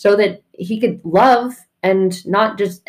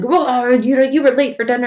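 mock indignant, higher pitched; retold complaint; 5 semitones higher, 3 dB louder than usual. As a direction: imitate an affronted dinner host. Acting out stepdad's complaint.

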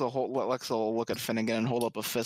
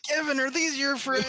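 high-pitched teasing put-on voice; mock taunting question; 13 semitones higher, 3 dB louder than usual. Adventurer showing Kevin the bodies, mock taunting. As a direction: tease the captive in a high voice. Players puppet dead goblins at captive.

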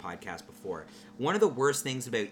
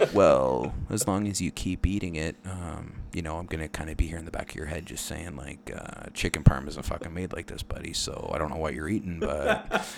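much lower, sly devil voice; devil on shoulder; 7 semitones lower than usual. Retold or imitated. The devil in his head mocking what he's eating, low and sly. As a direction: taunt him low and creepy like a devil. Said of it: "the devil on my shoulder".

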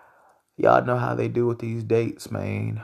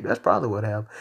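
ordinary delivery

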